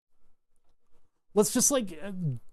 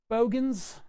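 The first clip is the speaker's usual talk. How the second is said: put-on half-hearted Australian accent, pitched up; attempted Australian accent; 7 semitones higher than usual. Vlogger testing an Australian accent before giving up, half-hearted Australian accent. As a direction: try out an Australian accent, badly. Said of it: "I can't do a good Australian accent".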